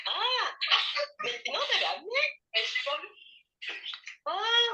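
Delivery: high "ah" of sudden realization; the pitch jumps up as it dawns on you; surprised realization. Real, not acted. Realizing the glasses were brought after all, surprised high ah.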